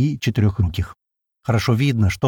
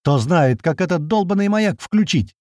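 narrated shout, higher pitch; exasperated cry to everyone around; acted yell with restrained volume; 7 semitones higher than usual. A narrator's reading. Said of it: "ору на весь лес".

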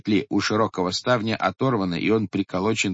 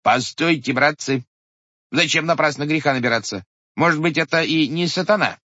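higher, urgent clerk holding the men back; cautious clerk; 7 semitones higher, 4 dB louder than usual. A narrator's reading. Clerk pleading with the crowd, raised and urgent. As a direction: warn the brothers not to sin hastily. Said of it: "сказал писарь".

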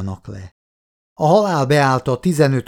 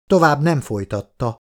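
ordinary delivery

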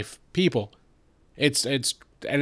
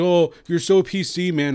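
mocking; 5 semitones higher, 4 dB louder than usual. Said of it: "They think".

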